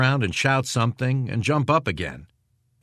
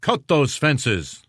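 slow, higher-pitched called order; calling out; 5 semitones higher, much slower than usual. A narrator's reading. Prankster imitating the major orders captain, calling out. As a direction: call out a drawn-out command. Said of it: "Lutz called out".

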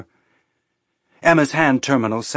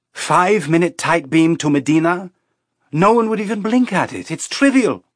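much higher, incredulous pleading; incredulous protest; 7 semitones higher, 3 dB louder than usual. A narrator's reading. Crewmate argues with captain over tight beam, incredulous. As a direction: argue it away as nothing, pitch leaping up.

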